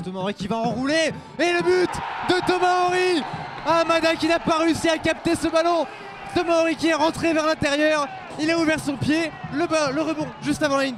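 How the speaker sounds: shouted goal call; excited sports commentary. Real, not acted.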